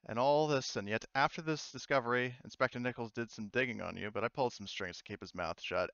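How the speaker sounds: usual speaking voice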